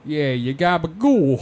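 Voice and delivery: put-on "gabagool" in a loud Jersey-Italian mobster accent, dropped "ca" to "ga"; mock Jersey mobster. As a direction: bellow "gabagool" like a Sopranos wiseguy. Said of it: "I'm gonna die like James Gandolfini".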